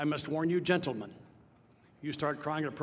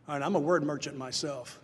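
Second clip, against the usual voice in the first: ordinary talk, nothing marked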